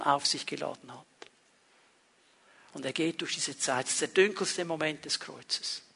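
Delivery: soft, low, intimate preaching; hushed sermon. Acted. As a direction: draw them in quietly to the darkest moment. Hushed, intimate passage on the cross.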